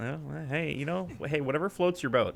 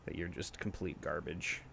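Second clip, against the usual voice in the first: teasing whisper; 7 semitones lower, 15 dB quieter than usual. Real, not acted. Teasing friend over gameplay.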